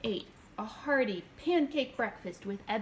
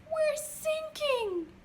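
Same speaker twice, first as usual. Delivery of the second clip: high, slow panicked shriek; slower than usual. A narrator's reading. Lucy notices the boat sinking, high slow shriek. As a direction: shriek it out in alarm. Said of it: "Lucy suddenly shrieked".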